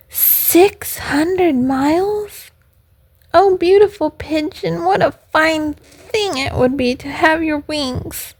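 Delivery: high puppet voice, amazed then longing; acted yearning little puppet; wistful puppet. A narrator's reading. Puppet wishes for pigeon's wings.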